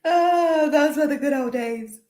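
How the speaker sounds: high, nostalgic sigh; mock-fond look back; wistful. Real, not acted.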